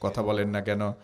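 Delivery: low, soft scolding aside; quiet chiding. Real, not acted. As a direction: drop way down and needle them gently. Preacher drops voice, chiding wives.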